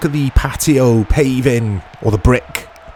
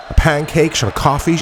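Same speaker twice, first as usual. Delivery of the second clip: ordinary talk, nothing marked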